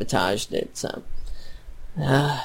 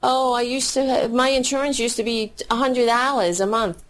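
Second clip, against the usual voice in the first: raised, whiny mock voice imitating people bragging about cheap insurance; a put-on naive complainer, higher; mocking whiny customer; 8 semitones higher than usual. Host mimicking customers who miss their cheap old insurance, mocking whine. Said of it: "But they're like".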